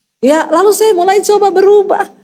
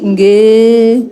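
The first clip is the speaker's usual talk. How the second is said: drawn-out, low, compliant yes; obedient wife; 6 semitones lower, 3 dB louder than usual. Retold or imitated. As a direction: answer like a dutifully obedient wife. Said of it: "Istri yang luar biasa. Melayani papa tuh kayak raja".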